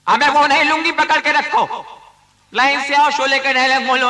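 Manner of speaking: exasperated casting director shouting; director scolding. Acted. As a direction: bark orders at the line of actors.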